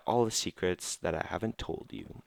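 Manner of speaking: normal talking voice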